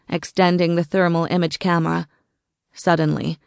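ordinary delivery

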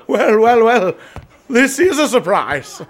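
high, loud, laughing welcome; jovial surprised greeting; delighted surprise. Acted. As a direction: greet an old friend with a laugh. Greets brother in flashback, surprised.

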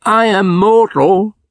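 remonstrating. A narrator's reading. Scrooge pleads with the spirit not to fly, high and slow. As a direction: protest in fear of falling, high and slow. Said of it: "Scrooge remonstrated".

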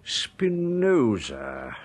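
slow, puzzled repeat of the strange name; baffled repetition. Acted. Old bookseller repeating the customer's request, slowly and baffled. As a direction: turn the name over, bewildered.

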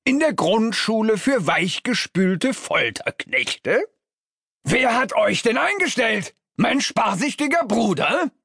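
high, indignant dressing-down; scornful outrage. A narrator's reading. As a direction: berate them with mounting disbelief. Boss berates incompetent staff.

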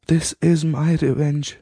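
a lightly voiced old queen, triumphant; declared. A dying queen declares her revenge to her son, lightly voiced. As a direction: declare it as her final victory. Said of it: "she declared".

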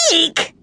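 short high-pitched squeal; eek. A narrator's reading. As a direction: squeal at a rat. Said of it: "said Belinda".

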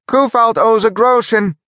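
very high squeaky voice; squeaked. A narrator's reading. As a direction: squeak it out like a mouse. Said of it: "And Monte squeaked".

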